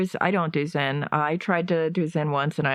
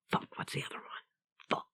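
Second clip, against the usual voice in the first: muttered under the breath; whispering; 15 dB quieter, much faster than usual. Real, not acted. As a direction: whisper it to yourself, annoyed. Forgetting word, whispered to self.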